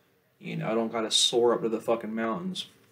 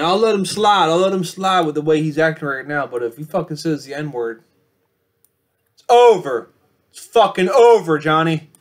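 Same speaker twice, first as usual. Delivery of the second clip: loud, higher threatening rant; loud warning; 5 semitones higher, 12 dB louder than usual. Acted. Vlogger warns rival about the N-word, loud threat. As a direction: warn him it's over.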